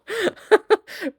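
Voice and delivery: high burst of real laughter; helpless high-pitched laugh. Real, not acted. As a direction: crack up at the story. Laughs at the story.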